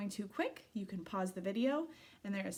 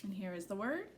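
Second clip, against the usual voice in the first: usual speaking voice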